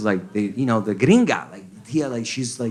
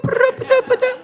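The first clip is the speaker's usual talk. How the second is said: robot beeps. Retold or imitated. Mimicking R2-D2 beeps.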